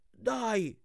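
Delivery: high, lightly acted cheer; urging on. A narrator's reading. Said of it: "mi incitò lui".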